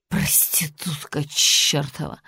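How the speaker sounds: slow whispered mutter; muttered curse. A narrator's reading. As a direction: grumble the insult under your breath. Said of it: "пробормотала Джанет".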